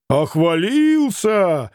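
lightly voiced jeering shout. A narrator's reading. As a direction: drag out a taunting cry. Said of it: "крикнул Ракитин".

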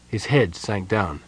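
ordinary delivery, nothing marked

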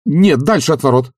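quick high objection; lively. A narrator's reading. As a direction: jump in to correct him. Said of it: "живо сказал Обломов".

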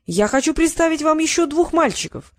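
teacher presents the newcomers to the dean, high and eager; upbeat introduction. A narrator's reading. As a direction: announce the new boys brightly. Teacher introduces two boys.

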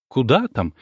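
ordinary delivery, nothing marked

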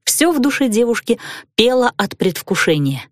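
plain reading voice

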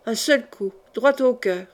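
usual speaking voice

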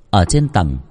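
plain reading voice

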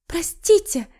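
high, squeaky sorry; clumsy girl squeaking an apology; squeaked apology. Acted. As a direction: squeak it out embarrassed. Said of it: "пискнуло".

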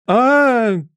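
long high-pitched wail; piercing scream. A narrator's reading. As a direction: scream like a steam whistle. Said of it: "кричала королева". (пронзительный протяжный крик)

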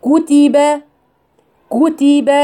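كتب with each vowel whole and clear; full vowels. Shown as a demonstration. Teacher models the correct vowels. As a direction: say كتب with a full ضمة and full فتحة. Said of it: "هو ده إتمام الحركات".

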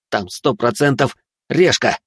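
ordinary delivery, nothing marked

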